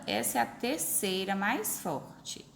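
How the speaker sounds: ordinary talk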